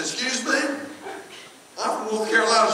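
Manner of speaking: high-pitched comic indignation; retold affronted squeak; mock indignant. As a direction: protest in a high, playfully offended voice. Mock offence at accent remark.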